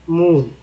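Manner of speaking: teacher repeats मून with long ऊ sound; model word moon. Shown as a demonstration. Teacher models मून to students, long ऊ. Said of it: "double O का उच्चारण ऊ के रूप में".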